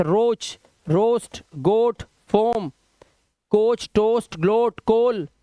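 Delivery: every word from "रोच" to "कोल" with a long o; word-by-word list, round oh in "रोस्ट", "कोच", "टोस्ट". Shown as a demonstration. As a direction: hold the oh in "गोट" and "फोम". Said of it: "सिर्फ और सिर्फ ओ".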